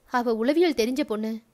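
plain reading voice

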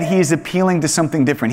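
ordinary talk

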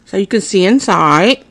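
talking while chewing; speaking between bites; mouth partly full. Real, not acted. Seen 1: Bit samosa at -2s, speaks mouth open holding bitten piece.

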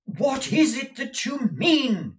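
very high, loud, slow shout; desperate shout. Acted. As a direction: shout it, frantic for an answer. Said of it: "cried Nicholas".

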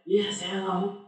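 soft, half-awake phone voice; acted sleepy answer. Answering Joe Biden's night call, mock sleepy. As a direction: mumble it as if barely awake. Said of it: "because I'm sleeping".

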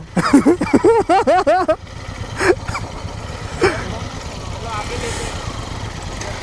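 high burst of laughter trailing off; real laughing fit, fading out; delighted laugh. Real, not acted. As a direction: let out a long, high laugh that dies away. Delighted reaction to the off-road spot.

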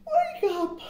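high, bright little squeak of waking; squeaky wake-up call; sudden high wake-up. A narrator's reading. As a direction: pop up high on it. Squirrel routine, sudden high voice.

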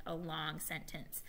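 ordinary talk, nothing marked

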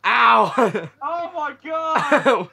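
drawn-out loud laughing yell; laughing shout. Real, not acted. Game panic, laughing shout.

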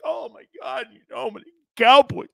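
high, excited fanboy voice; gushing Tony Romo impression. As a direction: gush like a starstruck fan. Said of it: "Tony Romeo was like".

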